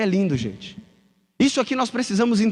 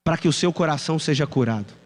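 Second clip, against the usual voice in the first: ordinary talk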